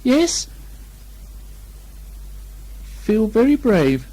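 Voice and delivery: high, slow squeaky voice forcing courage; a small pig trying hard to sound brave; Piglet bravely. A narrator's reading. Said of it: "he said bravely".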